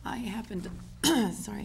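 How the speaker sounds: hoarse, cracking voice; losing voice. Real, not acted. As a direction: voice giving out mid-sentence. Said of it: "I'm losing my voice".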